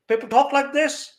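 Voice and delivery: imitated Scunthorpe accent, pitched high; northern English accent. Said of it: "there the accent's very different".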